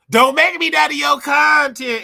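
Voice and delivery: high, loud and slow, mock outrage; loud outburst. Real, not acted. Streamer to her viewers, loud mock protest.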